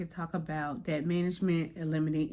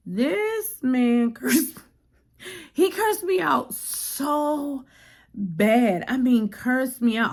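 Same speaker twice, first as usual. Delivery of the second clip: outraged; 6 semitones higher, 5 dB louder, much slower than usual. Real, not acted. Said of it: "outraged retelling".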